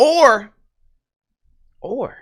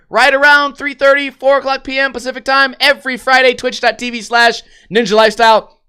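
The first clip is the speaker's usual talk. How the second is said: stream announcer; 6 semitones higher than usual. Acted. Streamer plugs his Friday stream to viewers, announcer style. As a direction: pitch the stream times like an ad.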